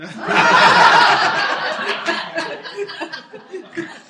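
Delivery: loud burst of real laughter; big laugh. Real, not acted. Remembering the field of cows.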